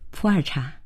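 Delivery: small soft laugh, then the words. A narrator's reading. She teases him after he drank her glass, laughing lightly. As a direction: say it softly just after a little laugh. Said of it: "沈化笑着对他说道".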